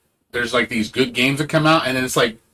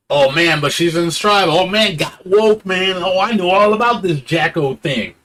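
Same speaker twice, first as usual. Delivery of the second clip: mocking fanboy; 7 semitones higher, 4 dB louder than usual. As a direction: ham up the smug latecomer fan. Mocking the fans' bragging.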